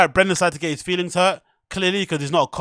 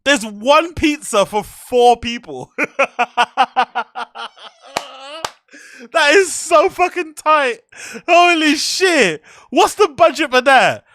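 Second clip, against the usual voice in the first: incredulous outburst; 7 semitones higher, 6 dB louder than usual. Real, not acted. Outraged laughing disbelief at pizza.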